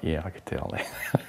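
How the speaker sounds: soft, breathy, whispered aside; dry whispered reply. Retold or imitated. Director dryly answering actor at wrap party, whispered. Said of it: "he's like".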